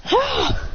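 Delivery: very high yelled ah; high anime yell; high battle cry. Acted. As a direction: scream as the lightning flies. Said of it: "then he goes".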